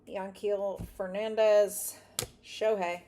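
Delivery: slow, loud, drawn-out name call; announcer callout. Real, not acted. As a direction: boom the name like a stadium announcer.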